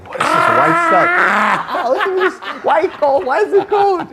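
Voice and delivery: put-on high, wide-eyed childlike wonder; amazed kid voice. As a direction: squeal it like a kid seeing snow. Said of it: "I'm like".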